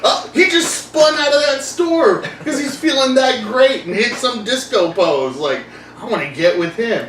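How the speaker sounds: high, half-shouted gushing admirer; mock swooning woman. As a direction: gush over the dancer. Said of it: "you know, like".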